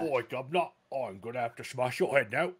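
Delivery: put-on a brutish orc with a British accent; playful British orc voice. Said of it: "Now I wanna hear a British orc".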